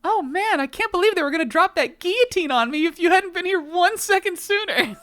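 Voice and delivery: excited. Acted. Saved from guillotine, excited.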